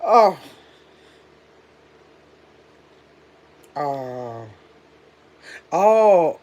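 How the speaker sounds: hiccuping between words; hiccups. Real, not acted. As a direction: caught by the hiccups. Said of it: "Oh, I got the hiccups".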